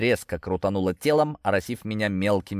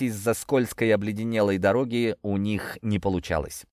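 ordinary delivery, nothing marked

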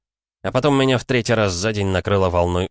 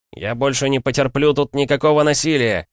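higher, louder firm proclamation; loud declaration; 7 semitones higher, 3 dB louder than usual. A narrator's reading. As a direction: announce it so all can hear. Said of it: "произнёс я, чтоб все слышали".

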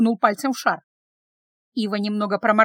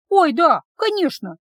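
high, flustered apology; abashed; 6 semitones higher, 5 dB louder, slower than usual. A narrator's reading. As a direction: agree hastily, embarrassed and pitched up. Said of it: "тут же устыдилась Ива". (смущённо, высоким голосом)

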